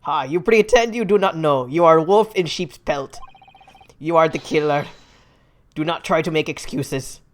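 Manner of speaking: put-on loud Russian-accented accusation; bad Russian accent. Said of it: "I can't do a good Russian accent".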